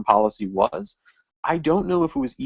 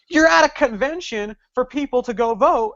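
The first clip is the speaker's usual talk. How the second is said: incredulous retort; 11 semitones higher than usual. Retold or imitated. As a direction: fire back in disbelief. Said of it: "I'm like".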